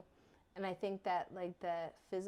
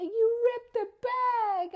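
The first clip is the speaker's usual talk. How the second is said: put-on much higher, loud slow wail of a child; wailing son; 13 dB louder, slower than usual. Mother imitating her son crying over a torn chip bag, loud wailing. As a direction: wail it like a heartbroken little boy. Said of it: "And he's like".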